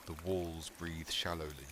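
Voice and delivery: plain reading voice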